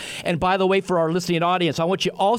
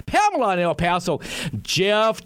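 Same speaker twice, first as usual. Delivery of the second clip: normal talking voice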